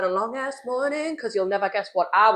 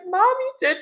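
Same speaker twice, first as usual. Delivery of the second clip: indignant; 13 semitones higher, 4 dB louder than usual. Retold or imitated. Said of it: "I was like".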